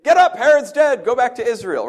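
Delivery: high, loud urgent call; angel's command. Retold or imitated. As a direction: wake him with the order. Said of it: "have an angel come to him and say".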